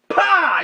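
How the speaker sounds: loud high "pah" impact; crash noise. Retold or imitated. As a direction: smack the sound out. Imitates hitting the dog.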